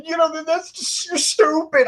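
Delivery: high mock-outraged sputtering; hammed-up high-pitched scolding; Coco. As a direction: imitate a co-host too indignant to finish. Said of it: "And Coco's like".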